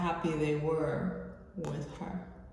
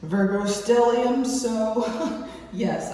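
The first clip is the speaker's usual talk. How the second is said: laughing; 5 semitones higher, 8 dB louder, slower than usual. Real, not acted.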